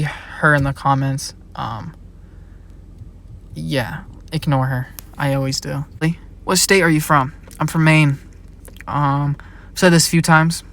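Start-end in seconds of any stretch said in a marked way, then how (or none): none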